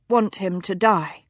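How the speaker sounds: usual speaking voice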